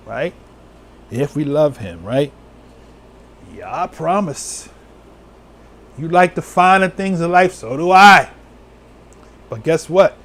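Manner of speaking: normal talking voice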